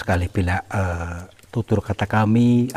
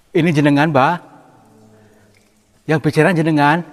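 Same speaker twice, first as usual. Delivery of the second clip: high, raised-voice question; loud questioning; 6 semitones higher, 5 dB louder, faster than usual. Real, not acted. Explorer asking the figure if it spoke, loud calling. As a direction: call the question over to them. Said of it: "intonasi bicaranya mungkin terlalu kenceng".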